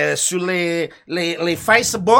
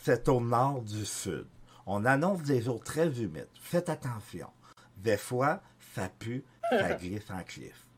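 Céline voice; 5 semitones lower than usual. Acted. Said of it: "la météo avec, euh, Céline".

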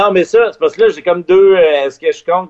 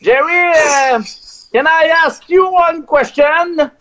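nervous radio guy fumbling in English; awkward English on air; 11 semitones higher than usual. Retold or imitated. On air, a French radio guy nervously introduces himself in English. Shy question in broken English.

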